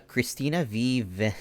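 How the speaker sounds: normal talking voice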